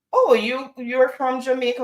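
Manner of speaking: high-pitched imitated astonishment; impressed surprise. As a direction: mimic an impressed patient, pitched well up. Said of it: "a lot of people are so impressed".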